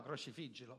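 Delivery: low, soft echo of the crowd's cry; hushed retold cry. Preacher asks why the crowd cried it, hushed retold cry. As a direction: repeat the mob's shout far under its volume. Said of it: "Perché gridava".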